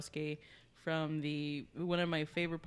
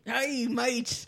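mock British; 6 semitones higher, 6 dB louder than usual.

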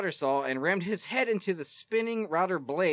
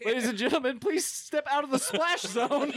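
show announcer; 6 semitones higher than usual. Pretend. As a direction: announce it to the crowd.

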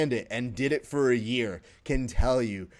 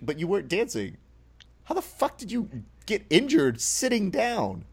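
incredulous retort; 9 semitones higher than usual. Acted. Host plays viewer answering Mariah's injury story, incredulous retort. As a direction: pitch leaping up in mock disbelief.

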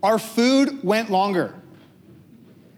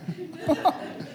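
short laugh; 9 semitones higher, 8 dB quieter than usual. Real, not acted. Laughs at his bad year.

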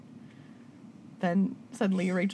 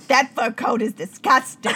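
Joan Rivers voice; 4 semitones higher, 7 dB louder than usual. Retold or imitated. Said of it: "Joan Rivers was probably like".

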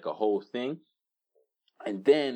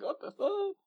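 high, baffled mock outrage; mock stunned dude; 4 dB quieter than usual. The robber falling back in the video, high baffled squeak. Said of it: "he's kinda like".

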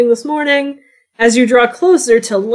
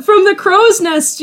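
ordinary talk, nothing marked

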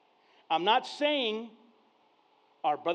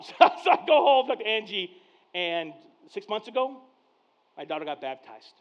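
ordinary talk, nothing marked

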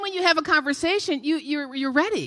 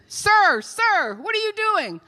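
higher-pitched mock yell from the shore; imitated yelling; 8 semitones higher, slower than usual. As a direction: call out to him like an officer, half shouting. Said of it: "they start yelling at him".

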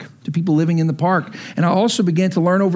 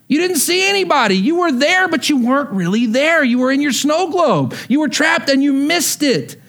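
stern Lord rising; 9 semitones higher than usual. Acted. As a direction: hammer the verdict home. The Lord answering, building.

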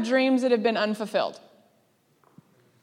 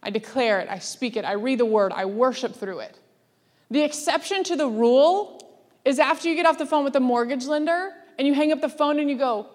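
ordinary talk, nothing marked

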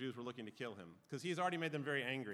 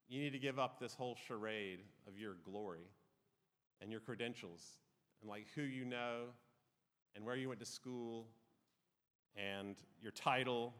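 softer, lower, gently pleading; tender and loving; 5 semitones lower, 5 dB quieter than usual. Acted. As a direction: speak it like a loving parent correcting a child. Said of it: "Jesus looks at them in love".

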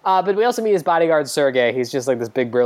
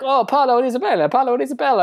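mock excited fan, higher and quick; lightly acted starstruck shout-out; 10 semitones higher, faster than usual. Fan excitedly recognising a singer.

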